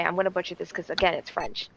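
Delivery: ordinary talk, nothing marked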